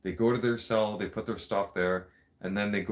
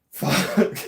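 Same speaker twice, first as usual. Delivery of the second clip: laughing curse; 11 semitones higher, 9 dB louder than usual. Real, not acted. Laughing after the prank.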